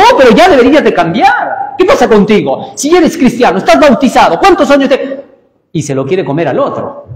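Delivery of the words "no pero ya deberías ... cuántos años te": higher, louder, fast scolding; a hammed-up nagging church member. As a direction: harangue him for never changing. Acted scolding of another believer.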